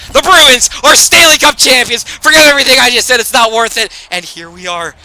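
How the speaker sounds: shouting with joy as the trophy goes up; excited celebration. Real, not acted. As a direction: cheer it like you just won the Cup. Celebrating the Stanley Cup win.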